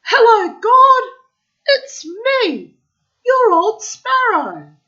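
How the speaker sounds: high, loud shout. A narrator's reading. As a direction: yell it across the workshop. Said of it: "he shouted".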